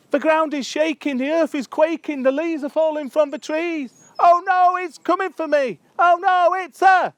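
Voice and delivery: high, loud panicked cry; hammed-up terror. Someone scared of a bumblebee, hammed-up terror. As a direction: wail the poem in mock panic. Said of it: "someone who's scared of one".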